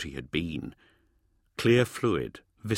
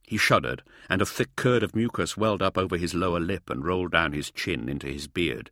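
ordinary delivery, nothing marked

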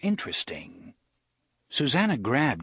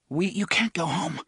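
frightened murmur; 7 semitones higher than usual. Acted. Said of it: "rapid, frightened murmur".

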